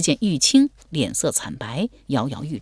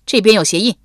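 loud, higher guard's call; 4 semitones higher, 7 dB louder than usual. A narrator's reading. Guard reporting tracks to fellow searchers, calling out. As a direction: alert the others loudly. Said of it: "有人叫道".

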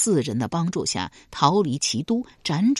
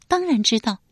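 put-on higher, fast, eager girl's voice; 少女轻快作答; quick girl's reply; 7 semitones higher than usual. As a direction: answer brightly and quickly. Yan'er answers.

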